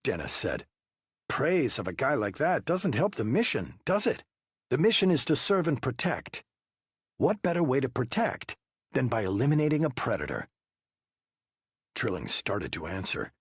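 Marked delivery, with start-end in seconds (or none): 1.3-10.5 high, pressing investigator voice; interrogating detective. Acted. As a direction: push him with pointed questions. Wu baiting Trilling.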